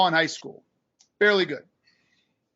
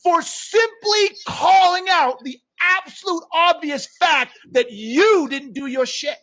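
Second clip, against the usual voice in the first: loud, high, emphatic rant; real indignation, hammering the key words; fired up; 7 semitones higher, 4 dB louder than usual. Real, not acted. Coach venting about being blamed for calling people out, fired up. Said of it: "I got fired up".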